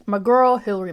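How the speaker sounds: loud, high excited cheer. Real, not acted. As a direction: greet her like a favourite. Excited about favourite author.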